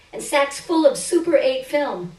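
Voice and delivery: ordinary delivery, nothing marked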